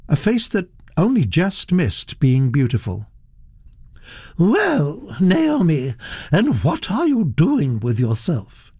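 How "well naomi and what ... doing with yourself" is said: higher-pitched, probing aristocratic lady; haughty inquiry; imperious Duchess. Acted. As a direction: question a young relative as a grand Duchess.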